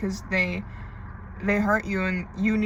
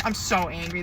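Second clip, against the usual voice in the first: ordinary talk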